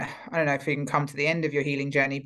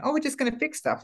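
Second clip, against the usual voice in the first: high, quick, dismissive mock cheer; mocking breezy voice; 10 semitones higher, faster than usual. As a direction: toss it off like a naive quick fix. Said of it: "It's not going".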